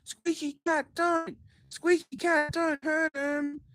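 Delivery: high, squeaky little cat voice; a squeaky toy cat pleading. Acted. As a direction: pitch it up like a tiny cartoon cat. Voicing the squeaky cat character.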